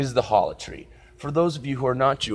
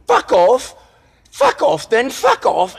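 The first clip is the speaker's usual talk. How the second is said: high mock British yell, much higher and louder; retold screaming; 12 semitones higher, 7 dB louder, slower than usual. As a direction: scream it at him like an angry British girl. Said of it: "I hear the three chicks screaming".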